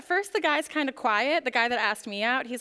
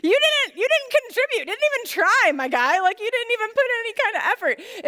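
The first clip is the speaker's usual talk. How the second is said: mock indignant retort; 9 semitones higher than usual. Telling the silent date off in mock indignant outrage. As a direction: fire back at him in hammed-up disbelief.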